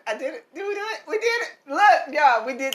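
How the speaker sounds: high, bright victory shout; excited. Real, not acted. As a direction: cheer it like a win. Celebrating cooking success.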